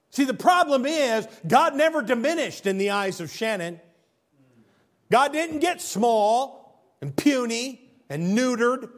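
high, loud pulpit emphasis; slow ringing stress on the last words; emphatic preaching. Acted. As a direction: drive the point home. Preacher's emphatic sermon peak.

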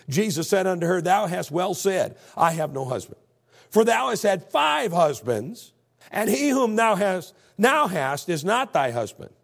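loud, slow, high declamation; preacher's scripture reading. Acted. Preacher reading Jesus' reply to the woman, slow forceful proclamation. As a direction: hammer out the verse, stretching key words.

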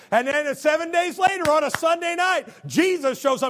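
loud, high storytelling; preaching at full force. Acted. Preacher tells the church of Thomas, loud. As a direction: push the story forward hard.

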